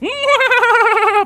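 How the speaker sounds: high, loud, wavering mock laugh. Gamer scoffing at the demon's threat, high mock laugh. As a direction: cackle loudly up high. Mocks villain's final-moments threat.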